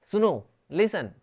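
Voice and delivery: normal talking voice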